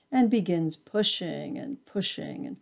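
ordinary delivery, nothing marked

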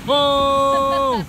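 high, loud amazed cry; excited whoa. Real, not acted. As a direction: let out a big amazed whoa. Rock stack stands.

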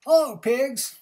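high, loud cheery duck greeting. A narrator's reading. Duck greeting pigs, high and loud. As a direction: call out brightly as Duck. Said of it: "said Duck".

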